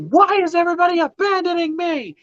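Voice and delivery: high whiny complaint; high-pitched mock wail; mock whiny outrage. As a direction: whine it like an abandoned kid. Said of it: "I'm pissed at this point".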